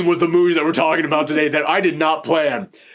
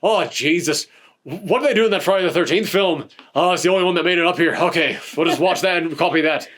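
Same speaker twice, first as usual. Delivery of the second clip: put-on Irish filmmaker's voice; Irish accent. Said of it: "that was the best Irish accent I've ever done".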